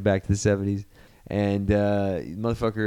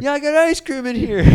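much higher, put-on whine about the ice cream; mock whiny voice; 4 dB louder than usual. The stuck guy protesting to Spider-Man in a high mock whine.

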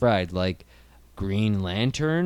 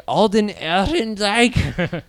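put-on German accent, higher and slow; 6 semitones higher, 5 dB louder, much slower than usual. Shown as a demonstration. Recalling German-sounding actor names, mock German accent. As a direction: drag the name out in mock German. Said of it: "super German names".

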